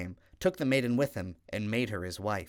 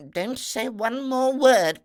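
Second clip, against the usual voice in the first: much higher, louder, slow furious command; stern stepmother; 14 semitones higher, 9 dB louder, slower than usual. Acted. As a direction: cut her off, every word a threat. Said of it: "the stepmother replied".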